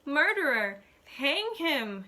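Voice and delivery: high, loud accusing screams; furious yelling. A narrator's reading. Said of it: "she yells".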